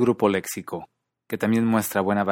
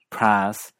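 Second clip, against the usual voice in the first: PRICE as regional American 'praas'; regional American accent; 4 dB louder than usual. Shown as a demonstration. Teacher recapping five accents, American PRICE with long 'a'. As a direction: drop the glide in American PRICE. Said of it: "en otras partes de Estados Unidos".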